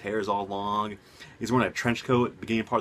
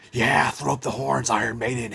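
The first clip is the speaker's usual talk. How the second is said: metal cheer; 5 dB louder than usual. Real, not acted. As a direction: hype it up like at a concert. Cheering for Iron Maiden.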